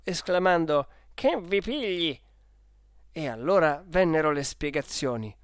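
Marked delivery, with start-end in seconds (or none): 1.1-2.2 a touch of startled exclamation, pitch raised; startled awake. Acted. Sleeper shaken awake by railway clerks, startled. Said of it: "si svegliò di soprassalto".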